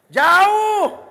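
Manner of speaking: high, loud cry of the word jauh; exclaimed far. Real, not acted. Preacher to congregation, exclaiming how far.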